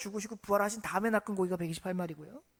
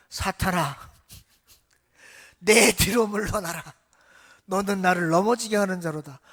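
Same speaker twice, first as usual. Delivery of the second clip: stern rebuke; 3 semitones lower, 6 dB louder than usual. Acted. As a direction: drive the tempter back with weight. Jesus rebukes Peter.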